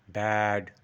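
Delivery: ব্যাড with a long, held vowel; stretched BAD. Shown as a demonstration. Teacher recapping the right sound, stretched ব্যাড. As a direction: draw out ব্যাড. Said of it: "একটু stretch করব".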